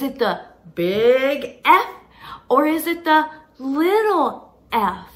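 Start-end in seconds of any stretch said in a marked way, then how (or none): none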